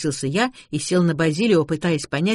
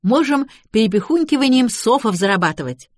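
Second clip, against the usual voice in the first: put-on higher, eager girl's proposal; lightly voiced girl; 6 semitones higher, 4 dB louder than usual. A narrator's reading. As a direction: pipe up with a raised pitch. Giggling helper makes a proposal.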